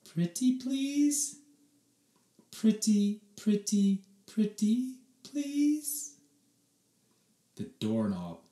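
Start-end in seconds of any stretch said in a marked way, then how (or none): 0.0-6.1 coaxing mom. A narrator's reading.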